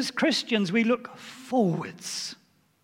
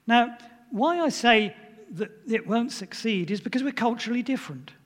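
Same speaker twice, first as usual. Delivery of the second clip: usual speaking voice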